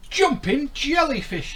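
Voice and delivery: high, loud fish exclamation; cried out. Acted. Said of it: "he cried".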